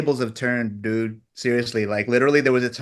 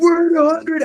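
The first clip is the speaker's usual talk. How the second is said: imitating the team, much higher and louder; excited cheer; 8 dB louder than usual. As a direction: squeal it like big news. Retold team cheering score.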